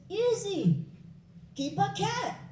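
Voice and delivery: breezy passer-by. Acted. Passer-by offers easy fix.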